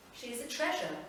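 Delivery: ordinary delivery, nothing marked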